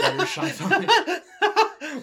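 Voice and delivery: cracking up mid-line; high, slow laughter through the words; laughing. Real, not acted. Reader bursts out laughing.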